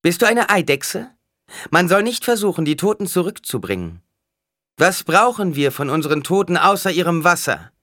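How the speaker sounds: loud, high scolding; sharp. A narrator's reading. Alia lectures the mother about the dead, sharply. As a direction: scold her before the whole crowd. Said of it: "Schärfe in der Stimme".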